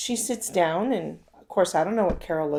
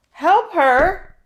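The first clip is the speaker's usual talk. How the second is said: much higher and much louder, incredulous; shocked disbelief; 8 semitones higher, 11 dB louder than usual. Retold or imitated. Vicky balks at her husband's advice, loudly incredulous. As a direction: throw it back like it's absurd.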